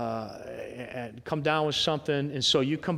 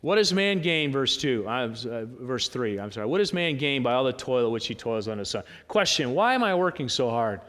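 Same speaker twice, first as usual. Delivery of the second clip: ordinary delivery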